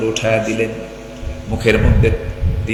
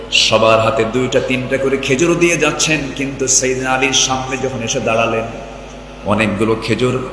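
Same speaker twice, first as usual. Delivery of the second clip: ordinary delivery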